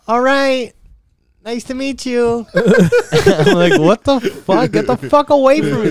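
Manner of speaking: put-on very high, drawn-out girlish voice; creepy girl. The lingering girl calling after him, very high. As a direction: squeal it like a weird girl saying goodbye. Girl's creepy farewell, his reply.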